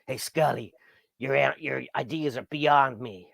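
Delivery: slow, put-on TV agent voice; John Doggett. Host mimics the X-Files agent talking to Scully, Doggett impression. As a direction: draw it out in a dry impression. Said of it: "I was doing a John Doggett voice".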